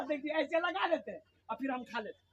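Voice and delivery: very soft, quick, off-hand; hushed, hurried punchline; confiding demonstration. Acted. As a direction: drop right down and show the trick. Collector sheepishly demonstrates his trick.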